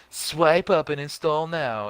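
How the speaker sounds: put-on slow, high robotic girl voice. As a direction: read it like a flat ad robot. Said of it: "this robotic girl voice is like".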